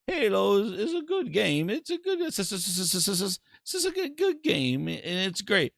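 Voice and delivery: high, halting mock CEO stammering over his words; lightly voiced stammering executive; mock stuttering Satya. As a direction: stumble through the praise like a nervous exec. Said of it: "and Satya's like".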